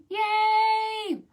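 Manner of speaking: very high sung yay; squealed, sung yay; high cheer. Real, not acted. Cheering children.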